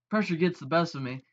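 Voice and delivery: normal talking voice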